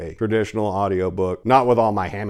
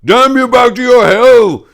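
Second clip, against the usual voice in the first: much higher, much louder roaring fiend; devil's roar; 16 dB louder, slower than usual. Acted. The devil roars at the paladin, full-force shout. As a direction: bellow the curse like a raging devil. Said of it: "He blew golden fire".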